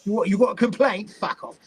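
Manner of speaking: mock-confrontational Cockney; Cockney accent. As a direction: imitate a rude Cockney complaint-line worker. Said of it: "like, a Cockney".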